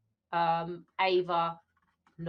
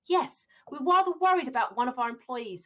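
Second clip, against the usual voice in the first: concerned employer; 4 semitones higher, 4 dB louder than usual. Acted. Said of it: "the concerned employer".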